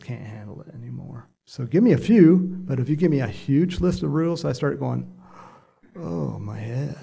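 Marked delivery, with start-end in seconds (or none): 6.0-7.0 slow, mildly overwhelmed "oh" of dismay; overwhelmed groan. Acted. Preacher acting out his reaction to too many rules, mildly overwhelmed. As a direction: play being lightly overwhelmed, drawn out slowly.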